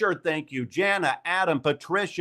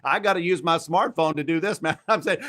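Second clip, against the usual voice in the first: usual speaking voice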